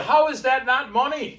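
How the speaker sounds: high, loud excited question. Acted. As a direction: sell it with a squeal. Pitching his bit, excited.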